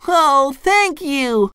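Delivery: high, drawn-out grateful cartoon cry; SpongeBob. A narrator's reading. Relieved fry cook thanking boss, crying out. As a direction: gush thanks in a slow squeak. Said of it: "SpongeBob cried".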